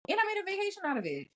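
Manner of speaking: high, fast and thrilled; excited. Real, not acted. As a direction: rush it out, delighted. Excited about trip.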